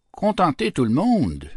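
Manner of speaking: high-pitched, acted astonishment; incredulous question. Malherbe answering Racan's wish to please all, incredulous. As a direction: throw the question back in disbelief.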